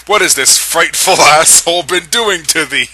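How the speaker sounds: breaking up mid-line; cracking up while voicing Edward; laughing through the line. A narrator's reading. Narrator giggling through Edward's line. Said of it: "he cried".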